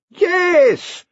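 a very high, loud, delighted yes; beaming yes. Acted. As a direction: burst with pride. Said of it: "beamed Nicolaus".

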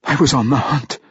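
ordinary delivery, nothing marked

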